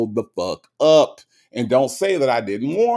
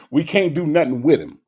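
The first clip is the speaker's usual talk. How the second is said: ordinary talk, nothing marked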